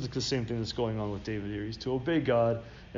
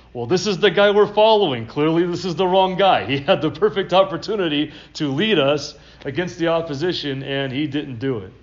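loud, higher mocking complaint from the troops; grumbling soldiers; 5 semitones higher, 8 dB louder than usual. Soldiers doubting David after he spares Saul, loud complaint. Soldiers turn on their leader.

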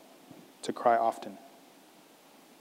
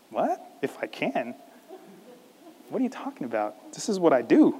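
mildly shocked. Acted. Jesus answers the father who doubted him, mock shock. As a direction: play lightly at being taken aback. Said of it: "It's as if Jesus is shocked".

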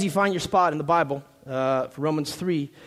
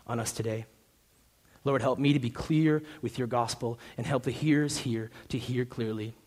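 prayer; 4 semitones lower, 4 dB quieter than usual. Acted. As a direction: pray quietly and earnestly, letting it fade. Pastor prays before sermon.

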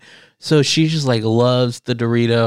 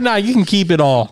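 breezy dismissal; 5 semitones higher than usual. Retold or imitated. Retold offer of Kit Kat.

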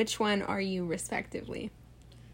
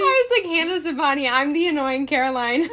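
laughing; 4 semitones higher, 4 dB louder, faster than usual. Retold or imitated. Retells own thought, laughing.

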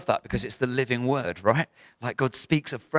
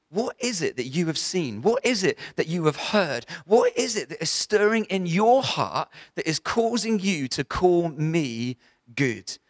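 raised, searching questions with drawn-out key words; earnest, probing appeal, pitched up; voicing Jesus's challenge; 4 semitones higher, slower than usual. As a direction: press each question home, slowly stretching "you" and "who I am". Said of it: "He's saying to this guy".

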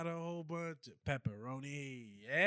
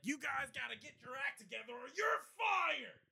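boss yelling; 12 semitones higher than usual. Shown as a demonstration. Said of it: "Like that".

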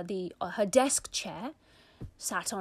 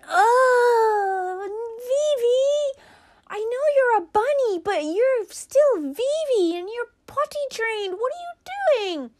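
put-on much higher and louder girlish wail of dismay; dismayed little girl; 9 dB louder, slower than usual. Big sister scolding her bunny brother for pooing, high wail.

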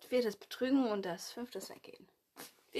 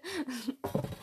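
short real laugh; Lachen; 3 semitones higher than usual. Real, not acted. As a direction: give in to a giggle. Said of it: "ich muss eben lachen". (kurzes echtes Loslachen)

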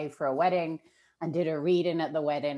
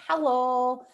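higher, louder hello in a Northern British accent; 9 semitones higher, 5 dB louder than usual. Shown as a demonstration. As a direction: call it out with a Northern English lilt. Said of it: "I like to do a Northern, like, British accent".

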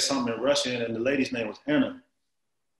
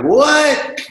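much higher, louder shocked yelp; shocked what; 10 dB louder than usual. Retold or imitated. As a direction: blurt it out in disbelief. Told bills had cocaine residue.

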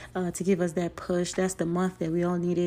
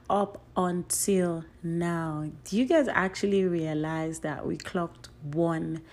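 usual speaking voice

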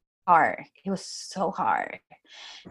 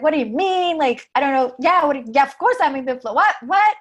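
defensive; 8 semitones higher, 6 dB louder than usual. Retold or imitated. Defensive reply to coach's question.

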